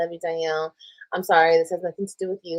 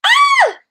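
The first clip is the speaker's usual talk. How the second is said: sudden high, loud shriek of alarm; a real startled yelp; panicked scream; 10 dB louder than usual. Real, not acted. Realizing she showed private texts on camera, shrieking.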